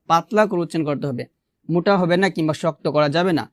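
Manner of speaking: ordinary talk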